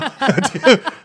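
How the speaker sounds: laughing. Real, not acted. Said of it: "흉내 내는 거".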